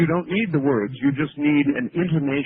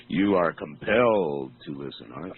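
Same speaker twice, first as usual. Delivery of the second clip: mock hypnotic; 11 semitones lower than usual. Host taunts caller in hypnotic voice.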